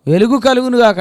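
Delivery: high, loud booming command; God commanding light, booming high; God's proclamation. Acted. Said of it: "ఏమన్నాడు".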